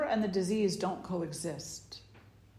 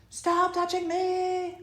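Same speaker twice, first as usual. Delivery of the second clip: put-on much higher, slow kid whine; whiny tattling little sibling; 11 semitones higher, 5 dB louder, slower than usual. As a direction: complain like a touchy child. Kid complaining about touch.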